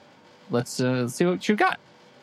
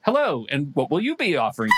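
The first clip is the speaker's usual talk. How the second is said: ordinary talk, nothing marked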